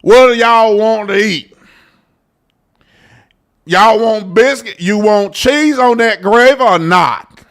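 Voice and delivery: a country diner waiter with a light Southern drawl; lightly voiced Southern server taking the order; Southern accent. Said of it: "and he got an accent".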